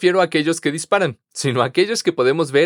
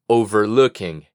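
Overlooking said slowly and clearly; slow model word; 6 semitones lower, slower than usual. Shown as a demonstration. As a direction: drill Overlooking slowly for learners.

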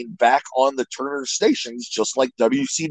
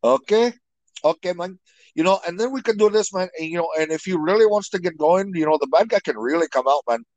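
a light Cuban-Miami accent, higher and fast; Razor Ramon accent; 6 semitones higher, faster than usual. Shown as a demonstration. Talking to the host in a light Cuban accent. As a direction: swagger through it in a hint of a Cuban accent. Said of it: "he talked like this".

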